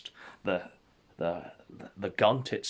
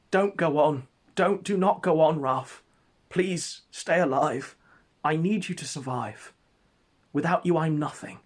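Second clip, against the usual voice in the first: higher-pitched, imploring voice; mock-heroic plea, raised pitch; desperate pleading; 5 semitones higher, 4 dB louder than usual. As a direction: beg a friend, hammed-up and urgent. Acted plea to a fallen friend.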